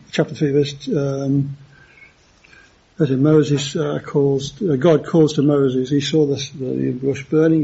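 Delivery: ordinary delivery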